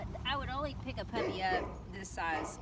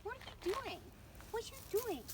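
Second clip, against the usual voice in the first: puppy talk; 12 semitones higher, 6 dB quieter than usual. Real, not acted. Talking to puppy.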